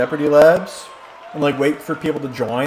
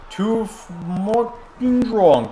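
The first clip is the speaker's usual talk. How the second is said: slow motion voice; 6 semitones higher, much slower than usual. Pretend. Same slow motion joke voice.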